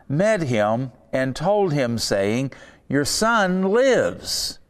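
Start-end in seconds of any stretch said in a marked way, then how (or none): 2.9-4.6 high, slow, ringing cry of good news; joyful exclamation. Retold or imitated. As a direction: announce it like wonderful news. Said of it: "exclamation point".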